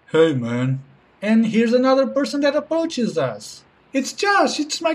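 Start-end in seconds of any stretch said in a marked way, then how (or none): 0.1-0.8 low guy voice. Acted.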